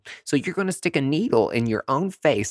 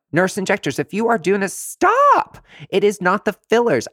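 outraged shout; 9 semitones higher, 4 dB louder than usual. Real, not acted. Yelling at nurse injectors to stop, very high and loud.

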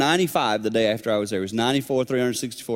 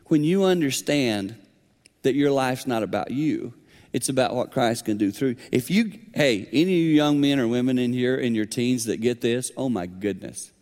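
normal talking voice

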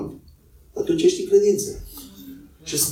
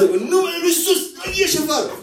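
higher-pitched hammed-up casting out, with a laugh; mock forceful rebuke; 10 semitones higher, faster than usual. Preacher mimicking how believers rebuke the devil, hammed-up command. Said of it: "știi cum le zburșim noi așa".

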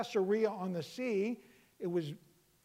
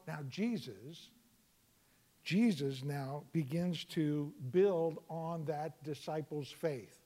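ordinary delivery

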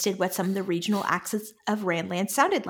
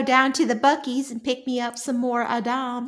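hammed-up Texan accent; Texan drawl; 3 semitones higher than usual. Host mixes Buc-ee's and Seanchan slang, Texan drawl. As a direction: play a Texan off on an errand. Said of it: "the Seanchan are Texas".

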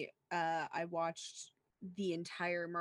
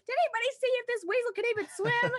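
laughing quote; 7 dB louder, faster than usual. Retold or imitated. Quoting roommate mid-laughter.

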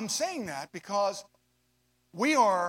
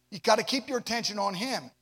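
ordinary talk

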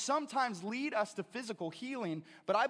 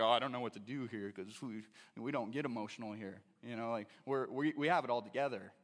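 much lower, stiff mock voice; mocking stuffy churchgoer; 10 semitones lower than usual. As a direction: parody the buttoned-up congregation.

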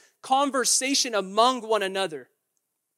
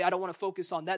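normal talking voice

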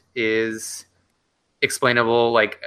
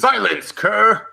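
high, loud theatrical villain command; Hendrick impression; 11 semitones higher, 4 dB louder, much slower than usual. Imitating the game's general with a booming theatrical command. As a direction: bark it like a hammy stage general. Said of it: "sounds like early Tim Curry".

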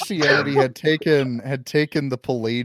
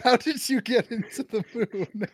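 high, giggling amazed question; laughing Marx quote; 11 semitones higher than usual. Retold or imitated. Retelling a tweet where Marx marvels at the moon, laughing. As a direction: ask it while cracking up. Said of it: "and he goes".

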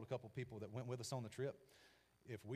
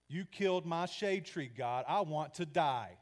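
loud, slow, higher protest; angry lament; 3 semitones higher, 8 dB louder, slower than usual. Acted. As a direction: hurl the grievance at God. Jonah sulking, angry at God.

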